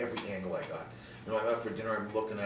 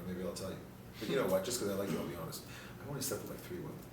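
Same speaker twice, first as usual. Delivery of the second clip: usual speaking voice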